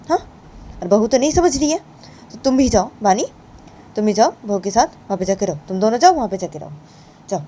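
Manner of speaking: ताना मारती गुस्से वाली चाची; angry aunt. Acted. Chhoti maa angrily sending them off.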